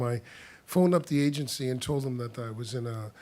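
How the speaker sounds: normal talking voice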